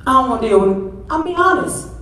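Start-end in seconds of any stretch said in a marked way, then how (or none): 1.1-1.9 rising preacher pitch. Real, not acted. First lady confessing her hurt feelings to the congregation, high and earnest. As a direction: pitch climbs high as she admits it.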